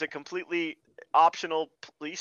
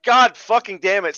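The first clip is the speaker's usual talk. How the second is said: mock annoyed outburst, louder; exasperated curse; 3 semitones higher, 8 dB louder than usual. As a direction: curse the film in mock frustration. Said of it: "I'm like".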